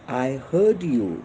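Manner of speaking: very slow, each word spaced out; slow model answer. Shown as a demonstration. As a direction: dictate it to the class word by word. Teacher models English answer. (शिक्षक वर्गाला उत्तर शिकवतो, अतिशय हळू)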